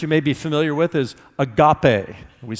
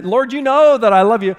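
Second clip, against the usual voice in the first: higher and louder, pleading his love; Peter's louder protest; 8 semitones higher, 7 dB louder than usual. Retold or imitated. Peter answers Jesus by the beach, raised and pleading. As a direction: insist on it, nearly calling out. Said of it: "And Peter says".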